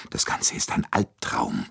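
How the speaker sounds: plain reading voice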